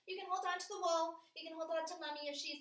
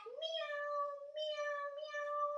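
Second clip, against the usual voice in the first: cat meow; 11 semitones higher, much slower than usual. Pretend. Teacher acting out cat pose for kids, high meowing. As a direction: mew like a little cat.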